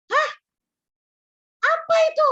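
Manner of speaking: the father's startled question as she hands him the food; surprised dad. Retold or imitated. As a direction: imitate a father caught off guard.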